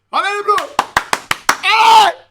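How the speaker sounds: high rowdy stadium cheer; rowdy party animal. Real, not acted. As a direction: bellow for the team. Cheering team before the kick.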